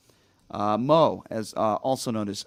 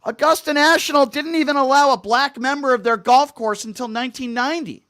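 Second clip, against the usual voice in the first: higher, louder indignant rant; serious rant; 11 semitones higher, 8 dB louder than usual. Real, not acted. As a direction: let the outrage rise in earnest. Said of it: "let me be serious here".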